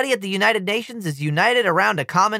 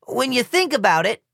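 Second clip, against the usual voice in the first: sputtering; 4 semitones higher than usual. A narrator's reading. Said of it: "I sputter into my microphone".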